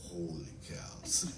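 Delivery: low, slow, soft amazement; retold awestruck murmur; stunned awe. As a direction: let it out slowly, low and hushed. Retelling astonishment on day seven.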